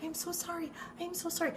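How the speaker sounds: high, soft, fluttery apology; a lightly voiced elderly lady fretting sorry; apologetic old neighbor. Neighbor at the fence apologizing to AJ, high and soft. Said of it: "my neighbor is at the fence going".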